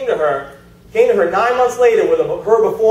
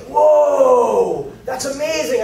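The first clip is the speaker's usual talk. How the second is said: long, higher, drawn-out whoa; astonished; 5 semitones higher, much slower than usual. Retold or imitated. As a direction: act out a friend's amazed reaction. Retelling Danny's astonished reaction.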